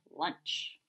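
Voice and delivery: a breathless, hushed fish voice; sinister whispered fish. Acted. Old fish eyeing Verdi, breathing lunch in a sinister hush. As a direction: hiss it like a hungry predator.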